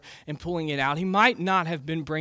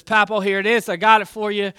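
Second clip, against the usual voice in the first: higher and louder, eager to please; proud kid showing off his work; eager grandson; 5 semitones higher, 8 dB louder than usual. Acted. Grandson proudly brings basket.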